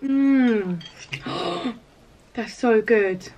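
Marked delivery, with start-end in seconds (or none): 1.1-1.7 a soft, high gasp; soft gasp of delight. Real, not acted. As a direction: gasp softly at the taste.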